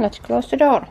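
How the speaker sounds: ordinary talk, nothing marked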